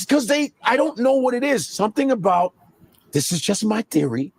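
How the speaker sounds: ordinary talk, nothing marked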